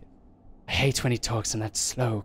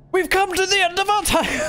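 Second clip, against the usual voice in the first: much higher, louder hammed-up proclamation; mock announcer; 6 dB louder than usual. Player mimicking the game's ghostly line, hammed-up and high. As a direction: bellow it like a theatrical ghost.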